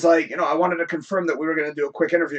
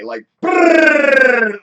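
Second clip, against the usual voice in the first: fast brrr sound; 6 semitones higher, 6 dB louder than usual. Retold or imitated. Rattling off long résumé.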